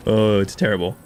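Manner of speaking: ordinary talk, nothing marked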